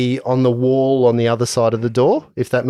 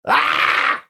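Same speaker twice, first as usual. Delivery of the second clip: panicked French yelp; 11 semitones higher than usual. Acted. Character cries out in panic.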